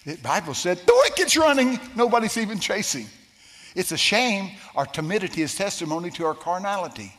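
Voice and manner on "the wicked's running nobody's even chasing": high, loud preaching cry; a fiery pulpit shout; preached shout. Acted. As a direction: belt the verse out. Preacher quoting scripture.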